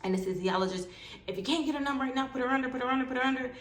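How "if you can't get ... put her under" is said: high, rapid-fire insistent commands; urgent orders. Retold or imitated. Surgeon to anesthesiologist, urgent. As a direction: press the order home repeatedly.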